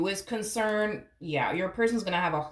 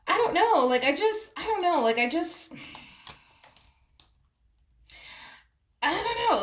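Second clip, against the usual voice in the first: exasperated; 13 semitones higher, 4 dB louder than usual. Acted. Voicing partner's confusion.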